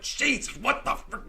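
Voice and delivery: high, loud mock exasperation; retold frustrated outburst. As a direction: throw up your hands in disbelief. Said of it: "Now I'm completely frustrated".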